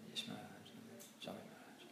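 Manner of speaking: soft timid apology; meek apology. Retold or imitated. Scolded disciple apologizing to the guru, meek and soft. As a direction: shrink back and apologize softly.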